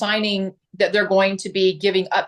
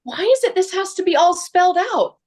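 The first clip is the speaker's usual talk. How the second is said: much higher, amused client voice; imitating a client; 10 semitones higher, 4 dB louder than usual. Agent imitating a buyer questioning all the paperwork, higher and amused. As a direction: play the puzzled client with a laugh in it. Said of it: "she laughed and she said".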